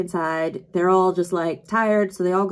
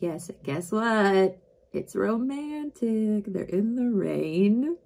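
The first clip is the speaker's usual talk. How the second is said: dreamy slow; 2 semitones higher, slower than usual. Acted. Dreamy romantic line.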